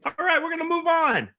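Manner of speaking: high, loud host call; calling time. Real, not acted. Host moving the game on, loud calling out. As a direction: cut in over the laughs to move on.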